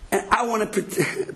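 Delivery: ordinary talk, nothing marked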